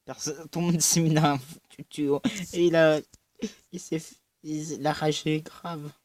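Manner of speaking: loud, rushed gaming exclamation; excited outburst. Real, not acted. Gamer tells viewers everyone hid in a pipe, loud. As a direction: blurt it out at the screen. Player exclaims during game.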